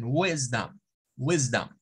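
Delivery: "wisdom" as WIS-dum, falling on "dom"; stress on first syllable. Shown as a demonstration. Teacher echoes the dictionary audio, first syllable stressed. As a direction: put the force on "wis", weaken "dom". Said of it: "la fuerza de voz está en".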